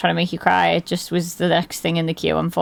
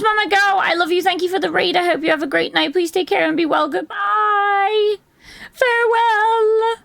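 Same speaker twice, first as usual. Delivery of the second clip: higher sing-song thanks with a laugh; high playful farewell; 10 semitones higher than usual. Pretend. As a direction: wave them off in a squeaky voice. Thanking a raider goodbye.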